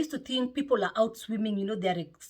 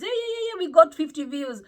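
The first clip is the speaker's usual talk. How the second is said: much higher, louder joyful shriek; excited squeal; 9 semitones higher, 8 dB louder than usual. Retold or imitated. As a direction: cheer like a fan at a match. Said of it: "I'm usually screaming".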